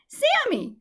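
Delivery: high, loud call; calling after the squirrel, high loud call; crying out. A narrator's reading. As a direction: call after her, alarmed. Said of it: "she cried".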